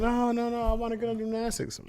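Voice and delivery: put-on high-pitched kid pleading; pleading little daughter. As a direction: imitate a child begging not to miss out. Voicing pleading small daughter.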